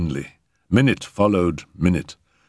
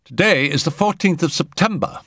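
high, emphatic correction; abruptly; 11 semitones higher than usual. A narrator's reading. Settle correcting Arthur's muddled date, abruptly. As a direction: state the date point-blank. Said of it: "said Settle abruptly".

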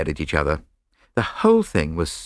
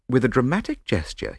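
usual speaking voice

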